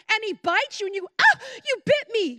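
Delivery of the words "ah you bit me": high, loud yelp of pain and shock; hammed-up startled yelp; bitten cry. Woman bitten by snake.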